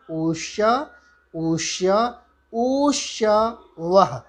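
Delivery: ऊष्य with the u held longer each time; drawn-out u sounds. Shown as a demonstration. Teacher demonstrating vowel length, u held longer. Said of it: "एक मात्रा".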